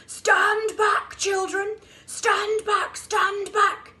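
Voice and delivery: loud, high bellow of alarm; shouted warning. A narrator's reading. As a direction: shout it across the jungle to stop the children. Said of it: "deep voice from the jungle shouted".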